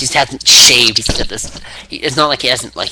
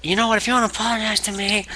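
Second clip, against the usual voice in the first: high, quick, softer put-on voice; imitated wrestler's offer; 11 semitones higher, 5 dB quieter, faster than usual. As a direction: pitch it up and rattle off the mock offer. Acting Austin's offer.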